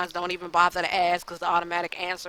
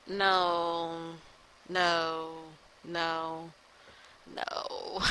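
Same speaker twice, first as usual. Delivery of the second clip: each no softer until it's only breath; fading refusals; 7 dB quieter, much slower than usual. Retold or imitated. Vlogger rejecting his own flopping videos, fading no's. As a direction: shake it off, trailing away to a whisper. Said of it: "Like, I'm just like".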